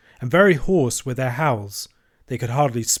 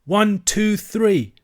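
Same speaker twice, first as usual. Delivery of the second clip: high counted yell, rising to three; 8 semitones higher, 3 dB louder, much slower than usual. A narrator's reading. Children yelling together from the tower, yelled count. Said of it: "Another yell, piercing".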